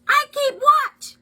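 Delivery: very high, loud crow squawk. Acted. Crow announcing its lookout to listeners, squawking crow voice.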